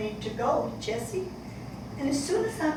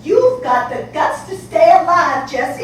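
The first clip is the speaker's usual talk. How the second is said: "you've got the guts" pushed out louder, urgent; louder pleading; 10 dB louder than usual. Acted. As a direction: she raises her voice to plead with her daughter. Mother pleads with daughter.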